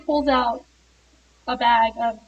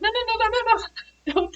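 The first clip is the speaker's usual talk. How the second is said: panicked no; 10 semitones higher than usual. Retold or imitated. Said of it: "And she's like".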